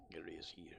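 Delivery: breathy unvoiced whisper; whispering his own name. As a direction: hush it like a secret. Whispered after calling Gary.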